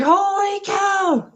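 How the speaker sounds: high, slow amazed gasp of words; drawn-out squeak of disbelief; amazed holy cow. Retold or imitated. Host retelling her shock at the video, high and slow. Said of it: "I was like".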